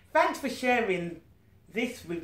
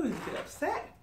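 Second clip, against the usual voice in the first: ordinary delivery, nothing marked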